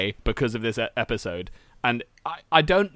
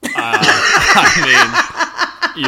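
laughing; 5 dB louder, much slower than usual. Real, not acted. Laughing reaction to crow story.

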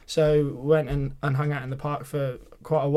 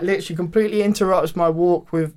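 usual speaking voice

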